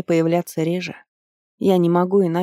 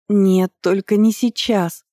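ordinary delivery

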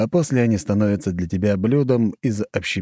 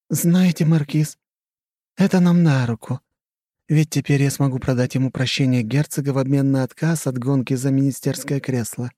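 higher, scheming society lady; coy and calculating, higher; flirty countess; 6 semitones higher than usual. Acted. Khrushcheva's voice.

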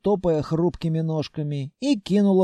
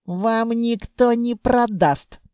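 slow, higher gloating; vengeful smirk; 7 semitones higher, 4 dB louder, slower than usual. A narrator's reading. Blonde tells the buyer off, gloating through a smirk. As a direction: draw it out with spiteful relish. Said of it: "с мстительной ухмылкой ответила".

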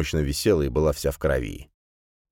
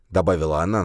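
ordinary delivery

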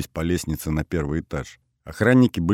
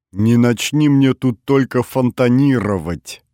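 loud, higher, drawn-out teasing jibe; guffawing joke; 6 semitones higher, 6 dB louder than usual. A narrator's reading. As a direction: crow the joke loudly and slowly, relishing it. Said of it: "заржал он".

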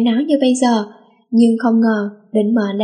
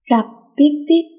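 imitated phone hang-up beeps; 5 semitones higher than usual. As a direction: beep like a dead phone line. Phone hangs up, beeping. (giả tiếng điện thoại tút tút)

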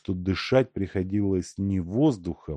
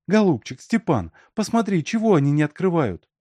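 high, urgent woman's request; anxious pleading; 9 semitones higher, 4 dB louder than usual. A narrator's reading. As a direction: coax the yard-keeper, voice raised high. Alyonka pleads with the yard-keeper. (взволнованная просьба высоким голосом)

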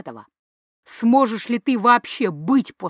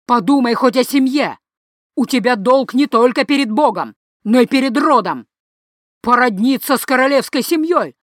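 loud, higher, furious yell; furious father shouting; 3 semitones higher, 5 dB louder than usual. Acted. Father yelling at his son about family duty, loud and high. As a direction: berate him with all your breath.

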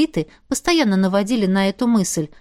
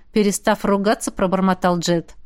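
plain reading voice

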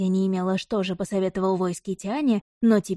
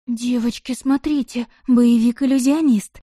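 breathy, higher, excited gasp; breathless excitement; 3 semitones higher, 5 dB louder than usual. A narrator's reading. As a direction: gush it out breathlessly. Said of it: "с придыханием объявила Тиана".

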